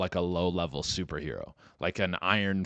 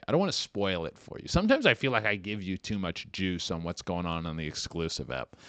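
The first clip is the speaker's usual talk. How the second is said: ordinary talk, nothing marked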